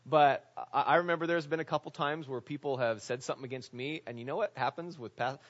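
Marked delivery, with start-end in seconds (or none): none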